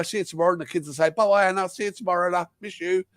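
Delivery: ordinary delivery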